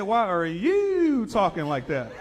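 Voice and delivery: slow, high, loud copy; mock slow echo. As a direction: echo her drawl back.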